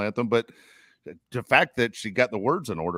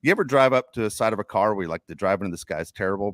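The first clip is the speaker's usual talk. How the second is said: ordinary delivery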